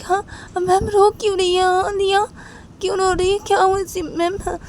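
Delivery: put-on very high child's voice, worried; anxious little girl. Little girl on the phone, anxious about the crying child. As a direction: ask urgently like a frightened child.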